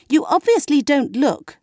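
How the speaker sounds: high, ringing, carrying voice; clear and penetrating. A narrator's reading. Margot correcting Leslie across the table, clear and ringing. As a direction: let it cut across the whole table. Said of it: "in a clear and penetrating voice".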